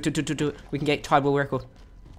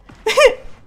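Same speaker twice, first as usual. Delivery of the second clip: loud high squeal of laughter; high disbelieving laugh; 10 dB louder than usual. Real, not acted. Enemy turned invisible.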